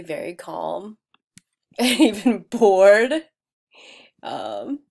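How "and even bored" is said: slow, louder, laughing it out. Real, not acted. Vlogger on calm animals, laughing. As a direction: laugh while saying it. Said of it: "I'm laughing at myself".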